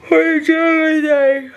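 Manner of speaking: words stretched slow through a yawn. Real, not acted. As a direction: talk through a big yawn. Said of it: "yawn as I talk about pre".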